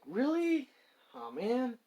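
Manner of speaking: acted disappointment. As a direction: voice a dismayed, disbelieving question. Disappointed reaction to bad news.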